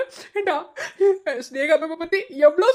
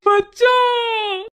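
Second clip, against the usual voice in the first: calling out; 2 semitones higher, much slower than usual. Acted. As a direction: call out to your buddy across the yard. Calling out to friend.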